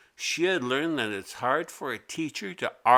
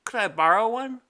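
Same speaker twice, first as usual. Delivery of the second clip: much higher, calling out to teacher; calling girl voice; 10 semitones higher, 5 dB louder, faster than usual. A narrator's reading. Mimi raising her hand to tell the teacher, high call. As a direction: call out across the classroom, high. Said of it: "Mimi asks teacher".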